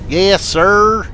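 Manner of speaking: high, drawn-out southern "Yes, sir"; southern accent. Retold or imitated. Recruit answering the drill instructor, in a Southern accent. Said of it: "the accent like this".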